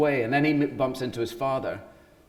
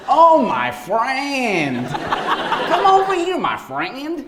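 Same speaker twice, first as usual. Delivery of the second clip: loud, high, drawn-out botched French turning Mexican; hammed-up failed French accent sliding into Mexican; Mexican-sounding French accent; 12 semitones higher, 7 dB louder, slower than usual. Actor mocking his own French accent attempt, comic Mexican-sounding accent. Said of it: "that makes everything Mexican".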